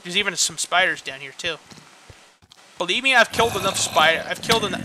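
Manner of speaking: ordinary talk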